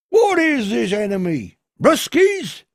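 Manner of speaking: high, loud suspicious query; old villager's question. A narrator's reading. Old man in a flat cap questions the colonel, high and loud. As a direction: demand who the enemy is.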